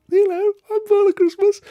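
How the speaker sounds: high, loud, jolly Father Christmas voice; playing Father Christmas. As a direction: boom out as a cheery Santa.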